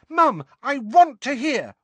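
high, loud wail. A narrator's reading. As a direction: wail it like a child. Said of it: "wailed Ginny".

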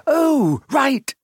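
very high, flustered realisation; sudden realisation. A narrator's reading. Hermione remembering she is a witch, sheepishly realising. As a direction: pitch it up as it dawns.

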